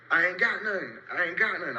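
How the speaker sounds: half-hearted imitation of yelling; retold yell. Trying to yell so homeboy will hear, retold mock yell. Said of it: "I'm trying to yell".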